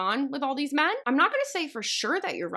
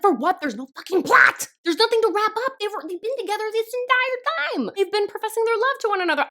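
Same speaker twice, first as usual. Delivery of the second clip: louder, higher exasperated rant, fast at the end; heated rant; 10 semitones higher, 4 dB louder than usual. Retold or imitated. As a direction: vent like you can't believe it. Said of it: "I'm getting too heated".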